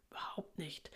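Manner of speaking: softly spoken, understated pertness; a touch snippy, kept quiet; lightly voiced saucy retort; mildly snippy. A narrator's reading. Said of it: "sagt Mali schnippisch".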